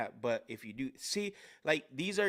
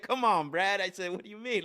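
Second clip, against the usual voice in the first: retold protest; 7 semitones higher, 5 dB louder than usual. Trainee protests to coach in story.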